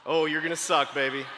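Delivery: low, matter-of-fact teasing. Real, not acted. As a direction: break the bad news lightly. Teasing the audience about first calls.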